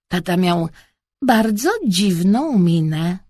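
ordinary delivery, nothing marked

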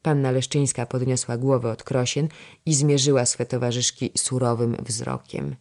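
ordinary delivery, nothing marked

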